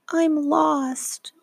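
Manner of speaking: put-on a frightened child admitting it; tiny little voice. A narrator's reading. Franklin alone in the woods admits he is lost, tiny voice. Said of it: "in a tiny little voice".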